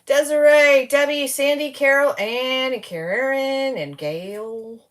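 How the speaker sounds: high, loud, drawn-out name calling; silly sing-song voice. Pretend. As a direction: call each name out like a goofy greeter. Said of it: "I don't know why I'm talking like that".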